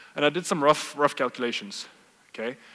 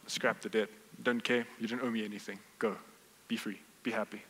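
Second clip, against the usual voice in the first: soft, hushed release of the debt, fading to a whisper; gentle forgiving king; 4 semitones lower, 8 dB quieter than usual. Acted. Compassionate king to kneeling servant, hushed and gentle. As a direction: let him go kindly, almost whispering.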